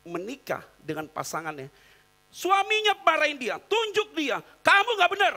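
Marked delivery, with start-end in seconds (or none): 4.6-5.3 mock angry husband. Husband scolding, pointing.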